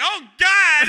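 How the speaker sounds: high, loud panicked yelp; frightened cry. Retold or imitated. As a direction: squeal it like you just hit a man. Terrified driver voiced.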